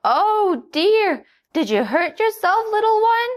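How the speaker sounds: very high, loud worried cluck; concerned hen. A narrator's reading. Hen worriedly comforts the hurt donkey. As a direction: rush to comfort him.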